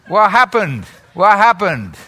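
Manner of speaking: ordinary delivery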